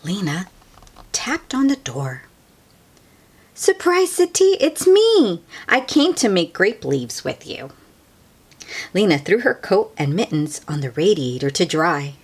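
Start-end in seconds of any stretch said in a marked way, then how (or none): 3.5-7.7 high, loud surprised greeting; a girl surprising her grandmother; excited child. Acted. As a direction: burst in with a happy surprise.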